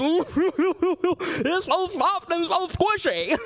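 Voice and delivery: put-on high, cooing, delighted kid voice; Damien. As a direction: gush over something fluffy in a high silly voice. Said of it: "This kid named Damien in my cabin".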